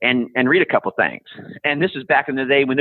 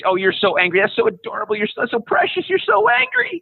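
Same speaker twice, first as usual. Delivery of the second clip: put-on high, patronizing baby-talk gushing; syrupy mock adoration; mock cooing; 8 semitones higher than usual. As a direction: coo as if at an adorable child, climbing higher. Cooing mockingly at angry youngsters.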